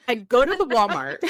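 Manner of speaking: ordinary talk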